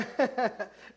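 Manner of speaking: a quick real laugh at his own joke; short laugh. Real, not acted. Pastor laughing to the congregation about Darren, a short laugh. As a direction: let a brief chuckle escape.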